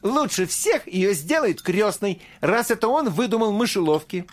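put-on high eager boy cry; Fritz shouting. A narrator's reading. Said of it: "вскричал Фриц".

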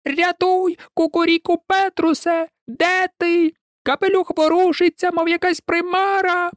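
very high-pitched panicked hen voice; clucking hens. A narrator's reading. Hens beg the rooster to save them, high cackling alarm. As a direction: squawk for help in a piercingly high put-on voice. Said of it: "куткудакали кури".

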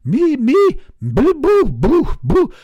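high, loud dog voice, thanks broken by barks; high loud barking thanks; grateful barking dog. A narrator's reading. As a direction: stammer thanks between barks. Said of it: "rispose il cane".

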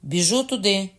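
loud call, pitched high; shout. A narrator's reading. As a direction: call out while running. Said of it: "крикнула Грюєр". (вигук)

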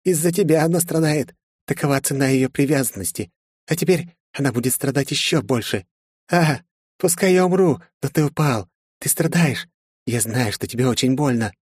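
high-pitched taunting woman's voice; gloating villainess. A narrator's reading. Dying Margaret torments the hero, high gloating voice. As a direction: relish the hero's pain.

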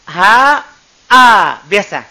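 ha from the lowest throat, then a plain a; throat letters demo. Shown as a demonstration. Teacher showing students the throat letters, loud clear demo. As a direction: sound out ha deep in the throat, then a. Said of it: "yang tengahnya itu dua huruf".

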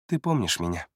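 low, understated question; matter-of-fact. A narrator's reading. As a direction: ask it as a simple statement of fact. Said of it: "Простая констатация факта".

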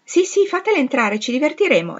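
high, loud youthful excitement; gleeful clamour of young people; eager exclamation. A narrator's reading. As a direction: cry out eagerly for some fun. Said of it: "esclamarono in coro tutti i giovani".

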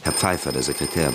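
usual speaking voice

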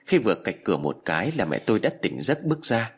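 plain reading voice

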